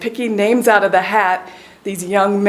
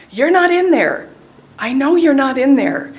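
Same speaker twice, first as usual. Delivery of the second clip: sure inner words to brother; 5 semitones higher, 5 dB louder than usual. Retold or imitated. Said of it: "this is in my mind".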